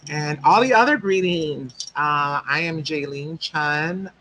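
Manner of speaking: usual speaking voice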